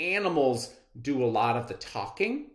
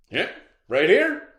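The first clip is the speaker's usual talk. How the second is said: louder, higher, triumphant; man shows proof; 7 semitones higher, 9 dB louder than usual. Acted. The man showing the lion the statue, loud and triumphant. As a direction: point at the statue proudly. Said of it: "said the man".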